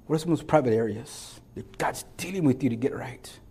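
soft unvoiced plea to the church; whispered appeal. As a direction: whisper the question close. Intimate altar-call question.

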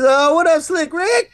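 high, hollered hello to a buddy; mock excited greeting. T-Bone hailing a friend out front, mock excited greeting. Imitating T-Bone's shouted greeting.

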